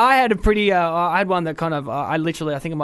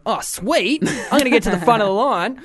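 higher, louder smug dude voice; smug queue jumper; 5 semitones higher, 4 dB louder than usual. Retold or imitated. Mimicking a man pushing ahead on the bus, smug. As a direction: chirp it like a pleased line-cutter. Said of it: "some dude from behind me was like".

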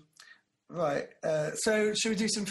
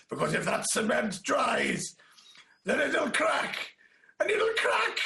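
high, drawn-out mock Welsh, building to a shout; hammed-up panic in a weird Welsh lilt; bizarre not-quite-Welsh accent; 8 semitones higher, 3 dB louder, slower than usual. Film hero phoning contractors about cement, overblown not-quite-Welsh accent. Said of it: "this really weird, bizarre, not quite Welsh accent".

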